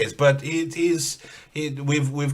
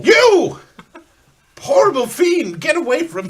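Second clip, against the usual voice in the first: horrified shout; 10 semitones higher, 7 dB louder than usual. Acted. Confronting the hated fiend.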